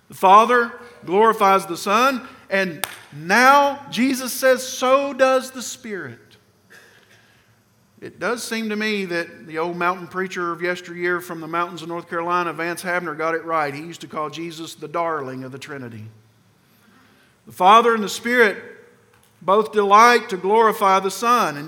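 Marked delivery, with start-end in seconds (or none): 0.1-6.2 louder, higher and slow, hammering it home; emphatic preaching. Real, not acted. Preacher drives the point home to his congregation, loud and slow. Sermon climax, restating the point. 17.5-21.6 emphatic preaching. Real, not acted. Sermon climax.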